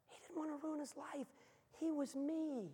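high, soft, earnest voice; small high voice, confiding; hushed pleading. Real, not acted. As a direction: plead softly, pitched well up. Preacher's hushed, pleading identification with prodigal.

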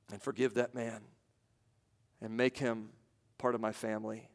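hushed and earnest. Real, not acted. Preacher imagining forgiving his attacker, hushed and earnest. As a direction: let each act of forgiveness land quietly. Gently building a picture of grace.

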